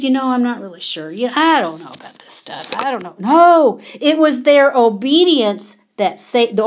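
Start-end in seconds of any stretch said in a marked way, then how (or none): 0.0-3.1 softer, trailing imitation of a waverer; mock doubting. Preacher imitating the children doubting instructions, mock doubting. 3.2-3.8 NO thrown out high and loud; emphatic rebuttal. Real, not acted. Preacher rejecting imagined doubts about obedience, emphatic NO.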